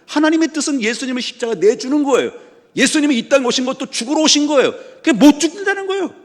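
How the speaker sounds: high, heated pulpit delivery; forceful preaching. Real, not acted. Preacher explains God's will of the cross to congregation, forceful preaching. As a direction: drive the point home, pitch well up, building to a cry.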